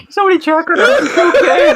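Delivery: high-pitched laughing shout. Real, not acted. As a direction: squeal it out mid-laugh. Cracking up at failed accent.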